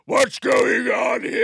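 loud, high, drawn-out shout; hammed-up producer's holler; Weinstein. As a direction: bellow slowly like a boorish mogul in the corner. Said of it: "like, a Weinstein in the corner".

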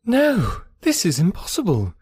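high-pitched shocked denial; startled disbelief. A narrator's reading. Emma reacting to Harriet's engagement, startled high disbelief. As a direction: leap up in pitch, refusing to believe it.

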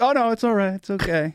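high, breezy mock-calm nurse; breezy reassurance. Intern nurse breezily calming the father. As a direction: brush it off lightly. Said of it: "And she's like".

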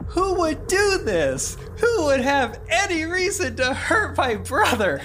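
very high, loud anguished wail; hammed-up distraught. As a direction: cry out slowly in theatrical grief. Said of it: "the pastor is so distraught".

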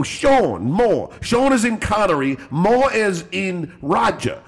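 slow, badly faked English accent. Prank caller explaining his fake name, bad English accent. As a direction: spell it out slowly in a hammed-up English accent. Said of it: "and I do it badly".

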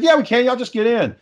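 high, brisk insistence; Sarah insisting. Retold or imitated. Sarah telling the group to pile into the van. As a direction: brush off the worry, all can fit.

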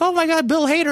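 high-pitched imitation of an excited old lady. Old lady nudging at a star's entrance, high excited imitation. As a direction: mimic a thrilled moviegoer, voice way up.

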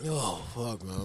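coughing fit, raspy and quiet; hoarse after a cough; smoker's cough. Real, not acted. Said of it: "That's that smoker's cough right there".

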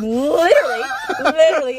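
loud laughing literally; laughing shout. Real, not acted. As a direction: blurt it out through laughter. Bursts out laughing loudly.

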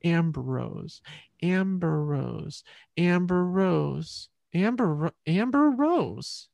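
Ambrose drawn out slowly until it splits into "Amber Rose"; slowed down. Shown as a demonstration. Child sounding out their middle name to themself, slowed down. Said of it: "And then I slowed it down".

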